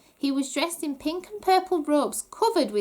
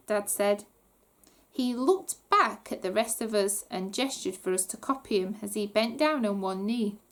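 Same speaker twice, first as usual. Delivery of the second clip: ordinary delivery, nothing marked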